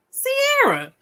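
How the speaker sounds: high sing-song taunt of a name; mocking high call.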